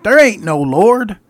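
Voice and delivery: loud, high, drawn-out retort; angry shout. Retold or imitated. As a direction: act out an atheist shouting back. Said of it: "he'd shout".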